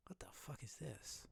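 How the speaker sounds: ordinary talk, nothing marked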